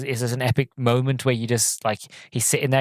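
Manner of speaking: normal talking voice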